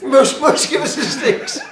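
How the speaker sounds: high, loud, squeaky mock customer; excited customer voice. Shopkeeper mimicking a keen customer, high and loud. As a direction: ham up the pushy punter. Customer over-enthusiastic at demo.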